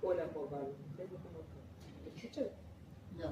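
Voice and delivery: faint voice missing the microphone; off mic. Shown as a demonstration. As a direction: speak away from the mic. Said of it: "si yo hablara así".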